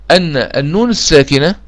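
normal talking voice

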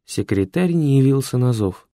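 plain reading voice

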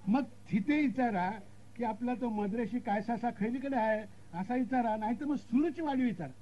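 high-pitched village guide; rustic direction-giver. Acted. Local telling strangers whom to ask next, high rustic voice.

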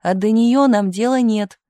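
high, loud outburst; shouting. A narrator's reading. Misha dismissing her before Tumanov, shouting. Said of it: "закричал Миша".

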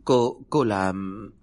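slow, strained stammer. A narrator's reading. Paralysed son straining to speak, slow stammer. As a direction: force the words out through a stiff tongue. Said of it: "lắp bắp được vài từ".

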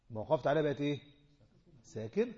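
normal talking voice

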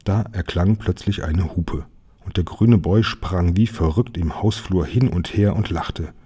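usual speaking voice

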